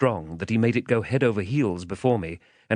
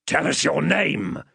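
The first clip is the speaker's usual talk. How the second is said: rough, raised demanding voice; higher-pitched, threatening ruffian; menacing convict; 7 semitones higher than usual. A narrator's reading. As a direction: order it out of a scared child. Convict interrogates the frightened boy.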